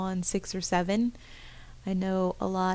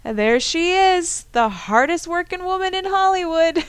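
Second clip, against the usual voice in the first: shouting out; 10 semitones higher, 10 dB louder than usual. Retold or imitated. Gym buddy greeting her from across the gym, shouting out. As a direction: call out loudly like a hype man. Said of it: "and he shouts out".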